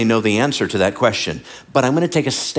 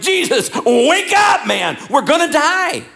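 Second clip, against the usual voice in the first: high, loud panicked shout, acted; freaking out; 3 dB louder, slower than usual. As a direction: yell in mock panic to wake a sleeper. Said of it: "Every one of them was freaking out".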